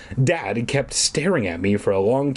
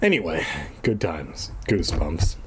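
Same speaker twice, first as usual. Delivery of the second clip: ordinary delivery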